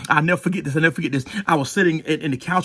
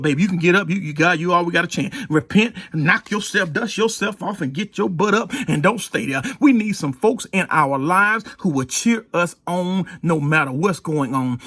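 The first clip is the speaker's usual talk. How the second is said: usual speaking voice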